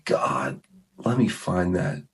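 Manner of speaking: quietly petition God, unhurried; retold prayer. Busser asking God for help finding the clip, hushed prayer. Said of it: "And so I say".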